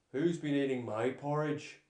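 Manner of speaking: loud, gruff papa bear growl; growling Daddy Bear. A narrator's reading. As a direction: growl it like a big father bear. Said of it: "growled Daddy Bear".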